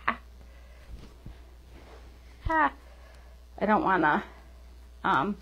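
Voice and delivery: usual speaking voice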